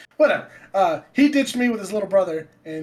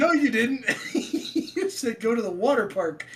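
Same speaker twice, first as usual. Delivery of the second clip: put-on laughing kid protest; 6 semitones higher than usual. Kid arguing back, laughing.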